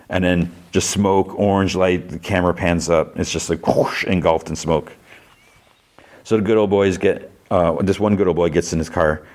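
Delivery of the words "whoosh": airy whooshing noise; imitated whoosh. Camera pans up as smoke engulfs them, imitated whoosh. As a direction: blow out a rushing smoke sound.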